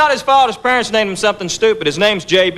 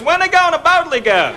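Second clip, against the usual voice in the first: high-pitched clueless question; puzzled dim buddy; 8 semitones higher than usual. Retold or imitated. Dim friend watching Star Trek, high and puzzled. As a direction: ask it high, honestly baffled.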